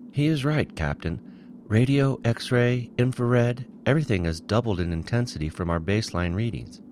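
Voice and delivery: a tense science officer, alarmed. A narrator's reading. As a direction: rattle off the readings to the captain. Officer character voice.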